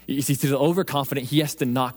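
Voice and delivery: ordinary talk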